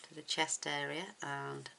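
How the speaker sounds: normal talking voice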